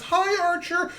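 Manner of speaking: imitated high-pitched squeal of hello; mock delighted neighbor, very high voice; excited neighbor greeting. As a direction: gush at a favorite dog, way up high. Neighbor greets beloved dog.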